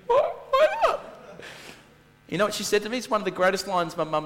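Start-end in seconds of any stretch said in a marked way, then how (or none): 0.1-1.0 mock outraged squeal. Retold protest to his mum.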